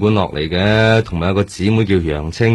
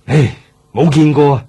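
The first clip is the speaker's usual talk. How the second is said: exasperated protest; 6 semitones higher, 4 dB louder than usual. Acted. Husband protests he never saw her.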